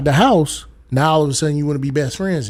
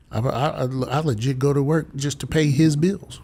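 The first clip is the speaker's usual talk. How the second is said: normal talking voice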